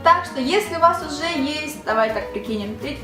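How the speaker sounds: ordinary talk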